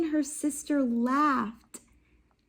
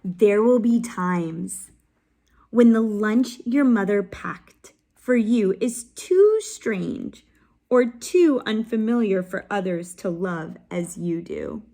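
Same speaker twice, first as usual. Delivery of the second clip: expressive read-aloud, words pushed out louder; story narration; 7 dB louder than usual. Acted. Teacher reading a picture book to her class, expressive storytelling.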